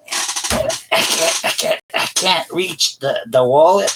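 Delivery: high squeaky gator straining for the wallet. Retold or imitated. Joking about why friends call him Gator, high squeaky gator. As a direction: play the short-armed gator, stretching and failing. Gator with short legs joke.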